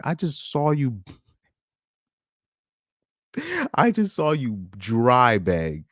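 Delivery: laughing through the words; laughing disbelief. Retold or imitated. Mocking a woman who claims independence, laughing. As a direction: chuckle at how obvious it is. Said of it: "And you're just like".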